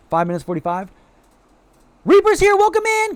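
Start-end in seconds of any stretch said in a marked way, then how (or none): 2.0-3.1 high, loud squealed greeting; excited welcome. Real, not acted. Streamer spotting Reaper arriving in chat, excited squeal.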